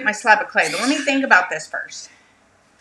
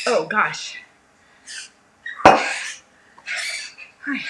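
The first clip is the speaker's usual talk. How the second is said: out of breath. Real, not acted. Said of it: "Let me catch my breath here".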